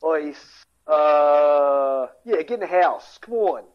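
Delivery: poor parody of a Philadelphia accent. Shown as a demonstration. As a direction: put on a clumsy, exaggerated Philadelphia accent. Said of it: "I'm doing a poor parody of it".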